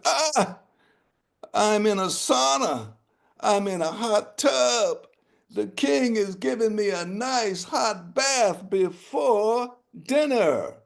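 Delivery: high, contented rooster voice; blissful rooster. Acted. Rooster in the boiling pot, high and blissful. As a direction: sigh into the hot bath like a happy bird.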